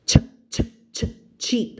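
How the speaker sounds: voiceless "ch" bursts, then "cheap"; cut-off CH sound. Shown as a demonstration. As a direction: punch out "ch" repeatedly before saying "cheap". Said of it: "มันจะค่อนข้างเสียงตัด". (ออกเสียง ch ตัดๆ แล้วพูด cheap)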